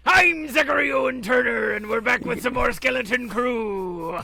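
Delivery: angry pirate voice. Acted. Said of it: "Zach's doing his angry pirate voice".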